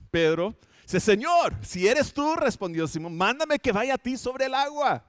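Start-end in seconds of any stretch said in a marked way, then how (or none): 1.0-2.4 higher and louder, calling out across the water; eager Peter calling out. Retold or imitated. Peter calls to Jesus across the water, higher and eager. 3.2-5.0 higher pitched, eager request; eager Peter pleading. Retold or imitated. Peter begs Jesus to call him, eager and higher.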